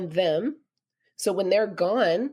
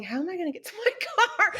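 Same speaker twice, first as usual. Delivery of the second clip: laughing; 10 semitones higher, faster than usual. Retold or imitated. Recalling being stuck in snow.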